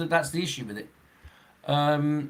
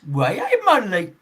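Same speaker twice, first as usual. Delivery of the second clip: mock Geordie, pitched up and louder; badly imitated Geordie greeting; Geordie accent; 4 semitones higher, 5 dB louder than usual. Shown as a demonstration. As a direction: put on a hammed-up Geordie accent. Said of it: "They speak like this".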